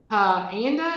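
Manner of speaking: "puh anda" for panda, split after puh; "puh anda", puh as its own beat; added schwa sound. A narrator's reading. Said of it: "He added his extra uh sound".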